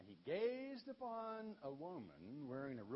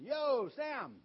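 loud shout, higher; calling out; 9 semitones higher, 14 dB louder than usual. Retold or imitated. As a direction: holler down into the cave. Calling down into the cave.

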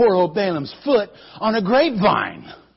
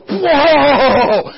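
loud laughing yelp; startled whoa; 7 semitones higher, 8 dB louder than usual. Acted. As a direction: thrown off guard, half laughing. Rider jolted, storyteller laughing.

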